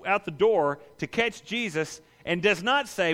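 ordinary talk

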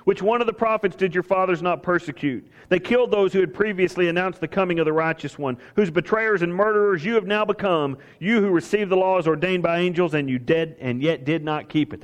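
usual speaking voice

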